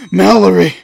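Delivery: loud, high cry of a daughter's name; alarmed shout. Acted. Father shouts for his daughter when the bell rings, panicked. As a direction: yell her name in sudden panic.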